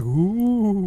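airy mock ghost moan; ghostly ooh. Shown as a demonstration. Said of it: "she talked like a ghost".

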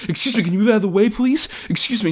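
mock timid guy. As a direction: play a nervous little office nerd asking to pass. McAvoy's timid request mocked.

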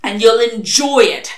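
relentless. Acted. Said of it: "Crowley said relentlessly".

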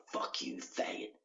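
hushed, breathy retold slur; whispered insult.

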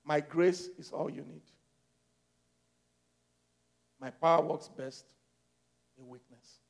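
low, very soft divine reply; God answering. Acted. God replying to Paul's prayer, low hushed answer. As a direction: answer quietly, with long pauses. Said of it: "God said".

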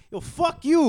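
high, loud mock New York accent. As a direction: shout it like a cartoon New Yorker.

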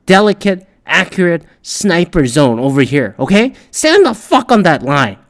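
high, loud barked commands; mock drill yell. Bossing people onto a line, high loud yell. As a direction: yell the orders like a drill sergeant.

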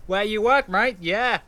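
high Aussie bloke scoffing; mock the beer in a broad Australian voice; Australian accent. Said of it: "the Aussie way".

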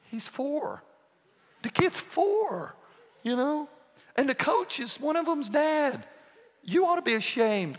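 hammed-up disbelief at the grown-ups; exasperated retort. Pastor retelling his reaction to the T-ball coaches, hammed-up exasperation. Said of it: "And I'm like".

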